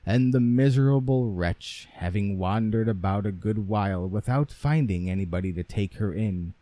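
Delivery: ordinary delivery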